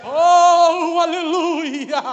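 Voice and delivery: very loud, long-held cry of praise; exultant shout. Real, not acted. Preacher praising God after the climax, exultant shout. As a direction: let the praise burst out at full force.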